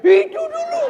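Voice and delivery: very high, loud squeal of "itu dulu" through laughter; over-the-top mock nag; mock nagging wife, laughing. Mocking wife's nagging refrain.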